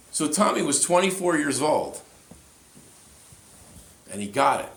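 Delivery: high, loud preacher's climax; soaring loud sermon cry; preaching at full force. Acted. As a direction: proclaim it like a thunderbolt hit you. Preaching climax about God.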